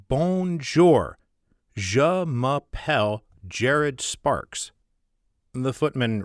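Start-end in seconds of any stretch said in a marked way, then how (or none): 0.1-4.7 slow, loud, mangled American French; heavily American-accented French. Acted. Sparks introducing himself to the footman, slow American French. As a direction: sound out the French like a struggling American. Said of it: "replies slowly in heavily American accented French".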